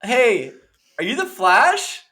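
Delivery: high, loud, slow; starstruck fan. Retold or imitated. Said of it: "she's like".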